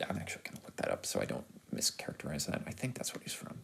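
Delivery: very soft, under the breath; muttered aside. Real, not acted. Reviewer checking an actor's credit, muttering quietly.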